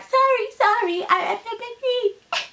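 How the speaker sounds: high, stammering apology; squeaky, stuttered sorry; mock groveling. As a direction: mimic cowering apologizers in a high voice. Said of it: "like the others are doing, like".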